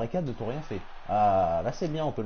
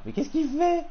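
high, loud burst of disbelief; high-pitched what-is-he-doing cry at the screen; incredulous shriek; 7 dB louder, slower than usual. Real, not acted. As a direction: squeal it, baffled by what he sees. Baffled by opponent's odd move.

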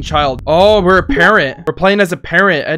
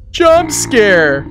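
louder, higher alarmed call; frightened shout; 5 semitones higher, 4 dB louder than usual. Real, not acted. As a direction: yell the warning in fright.

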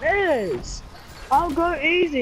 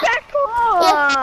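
put-on whiny toddler, a bit louder; baby voice; 7 semitones higher, 4 dB louder than usual. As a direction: pout it like a cross toddler. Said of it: "We're gonna have to act like babies now".

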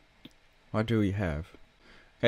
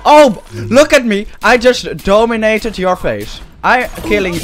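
loud taunting; 6 semitones higher, 8 dB louder than usual. Real, not acted. Taunting enemies while winning.

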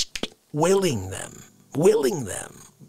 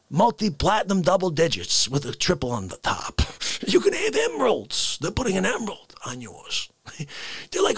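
normal talking voice

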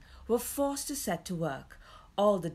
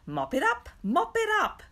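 high, louder crowing dwarf; gleeful cry; 12 semitones higher, 5 dB louder than usual. A narrator's reading. Dwarf orders the boy to mop, gleeful cry. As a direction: cry it out, enjoying the bossing. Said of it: "cried the dwarf, really enjoying himself".

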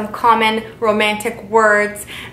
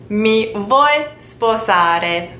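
Mi vuoi sposare drawn out even slower, each syllable held; even slower repeat; much slower than usual. Shown as a demonstration. Teacher repeating the proposal slowly for learners. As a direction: stretch the question out for learners.

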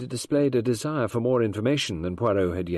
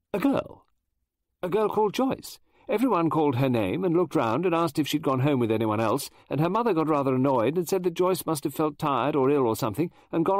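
higher, louder flustered lady novelist; Mrs Oliver voice; 7 semitones higher, 4 dB louder than usual. Acted. Mrs Oliver explaining schools to Poirot, high and eager. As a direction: chatter on like an excitable Englishwoman.

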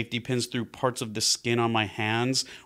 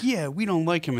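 higher-pitched mock agreement; agreeing along in dislike; 6 semitones higher than usual. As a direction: go along with your roommate's dislike. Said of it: "And I was like".

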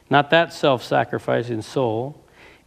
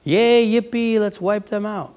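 mock glee; 5 semitones higher than usual. Mocking a gleeful destroying God.

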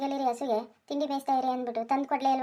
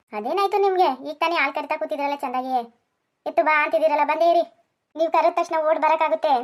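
higher and louder, exasperated; irritated shouting; 3 semitones higher, 7 dB louder than usual. Acted. Wife shouting back annoyed at her husband's calls, exasperated shout. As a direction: scold him from a distance.

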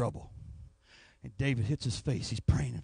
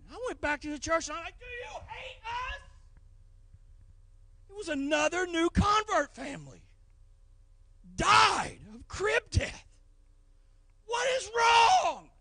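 crying shout. Retold or imitated. Preacher crying out to God after another death, high strained crying shout. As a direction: cry out in grief, almost breaking.

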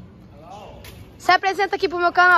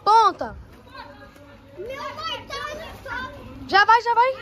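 yelling from the sidelines; 6 semitones higher than usual. Real, not acted. Cheering divers at pool.

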